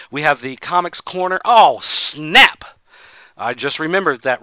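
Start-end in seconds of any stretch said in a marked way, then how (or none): 1.4-2.6 loud sudden realization; sudden surprise. Real, not acted. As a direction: blurt it out, caught off guard. Suddenly remembers forgotten task.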